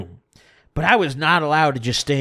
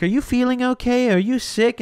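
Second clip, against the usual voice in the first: higher-pitched concerned questioning; lightly voiced grandma; 8 semitones higher than usual. Grandma asking her grandchild at dessert, lightly imitated concern.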